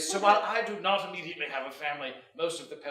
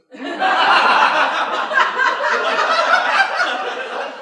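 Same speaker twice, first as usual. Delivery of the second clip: high loud laughter; 15 dB louder than usual. Real, not acted. Big laugh at the joke.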